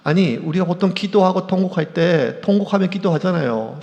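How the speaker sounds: usual speaking voice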